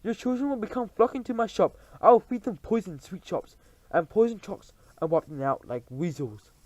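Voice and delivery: high, gleeful outburst; excited shout. Acted. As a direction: blurt it out with wicked glee. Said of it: "leapt to her feet and shouted".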